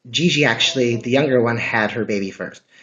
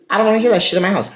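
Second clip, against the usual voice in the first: fast, louder outburst; fed-up rant; 8 semitones higher, 6 dB louder, much faster than usual. Real, not acted. Ranting to viewers about trumpet noise, fast and louder.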